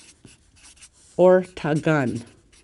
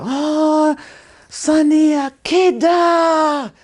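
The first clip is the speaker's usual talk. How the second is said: "Akida" stretched out high and loud; emphatic older speakers' Nakota; 11 semitones higher, 6 dB louder, much slower than usual. Retold or imitated. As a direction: imitate an aunt's drawn-out, high, loud "Akida". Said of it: "they really emphasize their Nakota when they talk".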